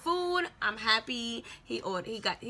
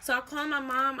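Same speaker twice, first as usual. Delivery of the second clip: ordinary talk, nothing marked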